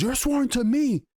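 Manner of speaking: mock queen ordering her guard; hammed-up royal command; imperious queen. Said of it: "she goes".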